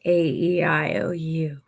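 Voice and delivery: vowels falling to a whisper. Shown as a demonstration. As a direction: seductive whisper. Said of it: "how you say things".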